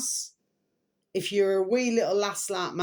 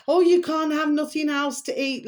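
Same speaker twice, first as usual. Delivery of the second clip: higher, louder mock voice of the stingy aunt; mean auntie; 8 semitones higher, 5 dB louder than usual. Imitating the aunt denying a hungry child food, mocking. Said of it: "like one of those".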